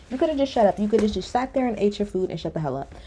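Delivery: ordinary delivery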